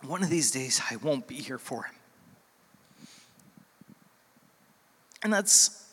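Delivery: holding back tears as he speaks; getting emotional. Real, not acted. Pastor telling the church about his son, emotional. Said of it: "Sorry, I'm getting emotional".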